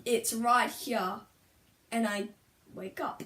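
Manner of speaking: ordinary delivery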